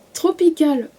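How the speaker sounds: English words in a strong French accent, slowly; bad English accent. Real, not acted. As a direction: stumble through the English title with a French accent. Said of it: "mon accent anglais est pourri". (très mauvais accent anglais, à la française)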